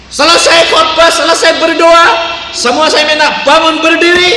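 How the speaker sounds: preacher at full force, declaring the promise; full-force preaching. Acted. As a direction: thunder it out to the congregation. Preaching climax.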